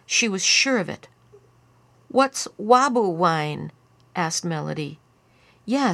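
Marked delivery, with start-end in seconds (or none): 2.1-3.7 put-on slow, louder toddler-like question; little child. A narrator's reading. Melody asking about robber's wine, puzzled small child. 5.6-5.9 short indignant "yeah". A narrator's reading. Lyle backing Melody's question, indignant. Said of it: "said Lyle indignantly".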